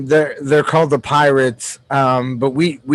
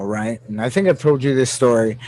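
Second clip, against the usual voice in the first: usual speaking voice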